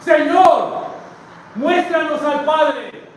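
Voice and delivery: loud, high preacher's rendering of a plea; acted plea. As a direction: call it out slowly, voicing a disciple's demand. Acts out a disciple's plea to Jesus. (súplica actuada en voz alta)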